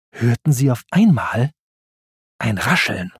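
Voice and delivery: usual speaking voice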